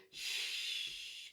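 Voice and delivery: very soft, whispered shush; faint, gentle shushing sound; too-quiet shush. Shown as a demonstration. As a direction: hush barely audibly. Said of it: "if your baby's still crying and quite loud, then there's no point in you going".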